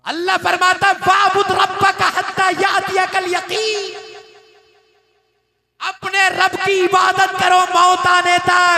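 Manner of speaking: loud, high-pitched sermon cry; fiery preacher's shout; preaching at full force. Acted. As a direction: call out the verse with full force. Preacher's loud Quran call.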